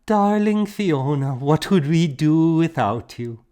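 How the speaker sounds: a touch of fond gratitude. A narrator's reading. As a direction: embrace her with words. Edith fond.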